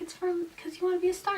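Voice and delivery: high, soft and fast; mock parents. Parents explaining the gift, high soft and quick. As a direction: rattle it off sweetly like parents. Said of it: "they're like".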